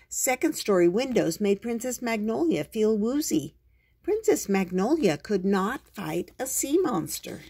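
usual speaking voice

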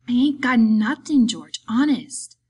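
put-on loud, high childlike protest; protesting simpleton. A narrator's reading. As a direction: protest innocence like a caught child. Simpleton caught hiding something, denies it.

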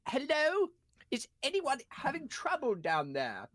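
calling out. Acted. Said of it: "I'll call out".

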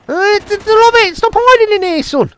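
very high, gloating gamer taunt; taunting squeal. Real, not acted. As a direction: squeal it at the player you caught. Taunting a hidden enemy player.

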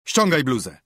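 teacher commanding a pupil, loud and harsh; stern teacher. Acted. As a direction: snap it out like an angry schoolmaster. Teacher orders boy in class.

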